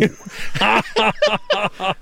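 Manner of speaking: laughing. Real, not acted. Laughing at the friend's line.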